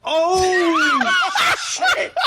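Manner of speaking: high squealing laugh through the words; laughing shock. Real, not acted. Cohost laughs in shock after Kevin disses French.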